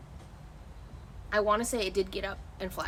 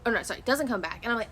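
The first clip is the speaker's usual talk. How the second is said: ordinary talk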